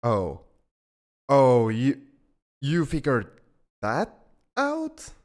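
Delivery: loud, halting shock rising high at the end; startled realization. Acted. Alien learns listener figured out the secret, startled. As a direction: caught out, voice jumping up in disbelief.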